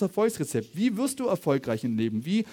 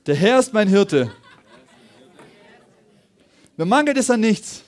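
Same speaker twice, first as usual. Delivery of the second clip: loud, raised proclamation in short phrases; congregational declaration; 4 semitones higher, 8 dB louder than usual. Real, not acted. Preacher leading the congregation in saying scripture, loud proclamation. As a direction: declare it boldly for all to repeat. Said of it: "Sagt mal mit mir".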